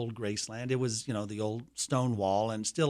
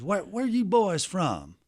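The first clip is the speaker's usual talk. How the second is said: higher, slow drawl imitating a security man; retold voice of a gatekeeper, drawn out and higher; Uncle Vester; 6 semitones higher, slower than usual. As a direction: imitate an old acquaintance's slow, raised-pitch question. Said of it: "I can hear him talking just like this".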